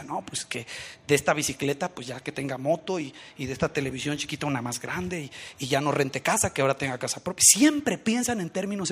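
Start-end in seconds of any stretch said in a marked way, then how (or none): 0.0-7.4 mock inner thoughts. People's materialistic thoughts voiced.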